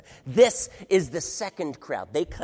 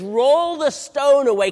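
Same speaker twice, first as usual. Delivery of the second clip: commanding order; 9 semitones higher, 12 dB louder than usual. Acted. Jesus orders stone rolled away.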